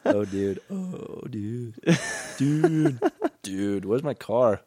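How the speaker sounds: drawn-out, dopey dude talk; slow dude impression. Joking about how he and friend talk, slow dude voice. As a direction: stretch every dude like a slacker. Playing slow-talking dudes for laughs.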